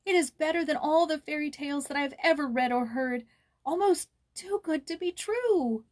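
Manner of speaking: high, slowing toward the end in awe; wondering delight. A narrator's reading. Joyce marvelling to Jules, high wondering delight. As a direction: savor every word of it. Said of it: "exclaimed Joyce".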